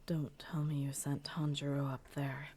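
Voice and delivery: hushed and uneasy; concerned whisper. A narrator's reading. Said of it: "his voice had a slight hint of concern".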